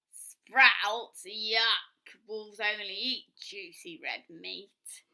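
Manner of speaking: loud, slow wolf growl of disgust; gruff wolf who hates sprouts. Acted.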